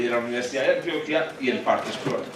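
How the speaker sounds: ordinary talk, nothing marked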